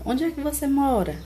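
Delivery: "Onde é que você mora" pitched high, falling on "mora"; question intonation. Shown as a demonstration. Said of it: "pelo jeito que a gente pergunta". ("Onde é que você mora" com entonação de pergunta)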